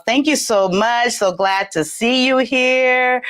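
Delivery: ordinary delivery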